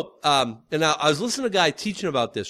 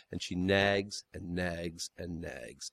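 mock whiny nagging; 11 semitones lower, 10 dB quieter, slower than usual. Husband complaining about nagging.

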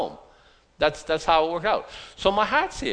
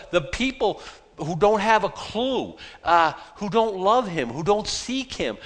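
building preaching; 3 semitones higher, 4 dB louder than usual. Acted. Preacher lamenting a broken city to his church, building fervor. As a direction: pile each phrase on heavier.

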